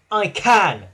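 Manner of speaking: loud huffy retort. A narrator's reading. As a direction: huff it back. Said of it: "huffed Thomas".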